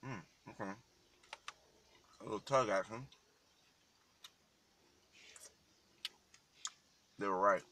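speaking mid-bite; chewing while talking. Real, not acted. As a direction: mouth full of sandwich. Seen 0: Bites sandwich at 0.1-0.9, then chews and talks with food in mouth.

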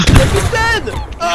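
crie fort et aigu; appel crié. Acted. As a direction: hurle le nom pour être entendu. Appelle le capitaine sous le feu. (appel crié)

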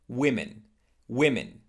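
WOMEN as "WIM-in"; plural sound. Shown as a demonstration. Teacher modelling the plural, women as wim-in. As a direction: say "women" with a short I first. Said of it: "the plural one".

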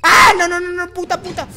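high, frantic repeated no; panicked nos. Real, not acted. Player attacked again, frantic panicked nos. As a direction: panic in real fear. Rat jumpscare.

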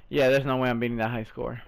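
ordinary delivery

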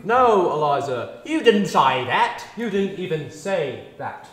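loud, slow and stern; stern scolding. Acted. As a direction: scold her firmly, word by word. Teacher scolding wrong vowel.

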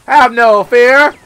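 loud, slow hammed-up proclamation; mock hero. Camel rider reassuring the citizens, slow mock heroic. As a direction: stretch it out like a grand herald.

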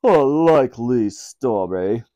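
slow, dubious Queen; sceptical royal murmur. A narrator's reading. Queen doubting the thieves' promise, slow and sceptical. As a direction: draw it out in disbelief. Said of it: "murmured the Queen".

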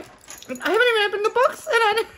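real happy tears welling up; teary. Real, not acted. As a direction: near tears with joy, pitch shooting up. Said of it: "and I'm getting teary".

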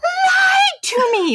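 very high, drawn-out shriek; retold angry scream. Wife screams at husband on the phone again, acted angry scream. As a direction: scream it down the phone, every word stretched. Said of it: "I just screamed it again".

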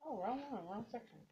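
normal talking voice

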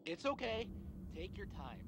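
soft, fading reassurance. Acted. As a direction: coax them gently, trailing off. Game character coaxing player softly.

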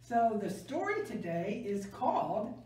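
plain reading voice

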